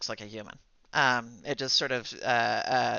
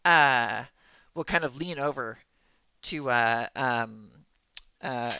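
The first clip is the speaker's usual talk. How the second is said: ordinary delivery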